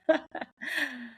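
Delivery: a short, high, breathy chuckle; wry laugh. Real, not acted. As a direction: laugh in disbelief, softly. Wry laugh about same president.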